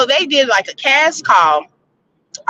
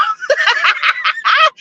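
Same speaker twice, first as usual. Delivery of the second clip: high laugh. Real, not acted. Laughs at own line.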